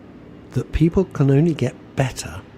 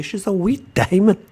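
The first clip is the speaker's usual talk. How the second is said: a light hammed-up Scottish lilt on wee diamond, pitched higher; mock Scottish; 7 semitones higher than usual. Host echoing a listener's text in mock Scottish. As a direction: relish it in a playful Scottish accent. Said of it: "Are you actually Scottish".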